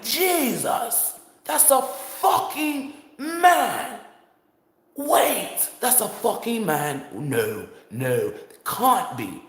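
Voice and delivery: high, drawn-out shock, easing into denial; appalled disbelief. Retold or imitated. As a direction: stretch it out in horrified amazement. Acts out the same man's disbelief.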